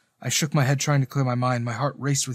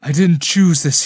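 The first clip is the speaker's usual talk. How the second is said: louder plea, creeping desperation; desperate exclamation; 3 semitones higher, 8 dB louder than usual. A narrator's reading. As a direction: protest loudly, desperate to be believed. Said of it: "he exclaimed".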